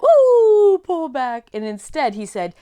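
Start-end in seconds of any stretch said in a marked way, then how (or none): 0.0-0.8 high loud startled ooh; catching oneself gasp. Acted. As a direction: stop yourself short. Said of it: "starts to come out and like".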